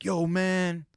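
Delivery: high, drawn-out "yo, man" in mock upset; mildly hammed-up emotion; retold emotional. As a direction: imitate an emotional friend, pitch lifted. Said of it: "getting real emotional".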